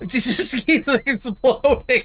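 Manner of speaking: high, loud, slow words broken by laughter; helpless laughing shout; laughing exclamation. Real, not acted. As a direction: crack up while crying out in disbelief. Laughs at repeated explosion.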